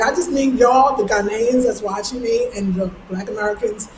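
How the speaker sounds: normal talking voice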